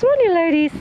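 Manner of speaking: high sweet voice to hens; coaxing the hens. Real, not acted. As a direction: coax the chickens fondly.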